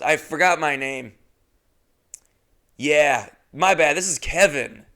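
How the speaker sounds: usual speaking voice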